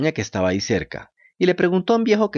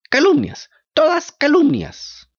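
high, loud outraged puppet; indignant protest; 4 dB louder, slower than usual. A narrator's reading. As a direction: deny it hotly.

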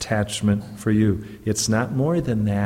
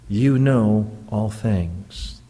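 slow confession; 2 semitones lower, much slower than usual. Acted. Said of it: "Peter speaks to Jesus".

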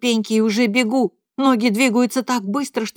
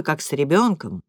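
father repeating wife's words, low; low mocking father; 6 semitones lower than usual. A narrator's reading. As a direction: echo her words coldly. Father echoes mother's words.

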